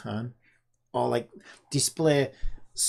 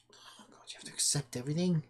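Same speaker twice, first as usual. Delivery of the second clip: hushed whisper of dismay; 7 dB quieter than usual. Real, not acted. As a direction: breathe it out under your breath.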